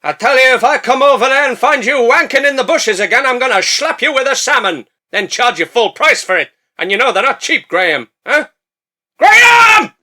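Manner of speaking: high, loud angry yelling; shouting captain. Acted. Captain shouting at Graham from the boat. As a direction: bellow across the beach. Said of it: "so he shouts".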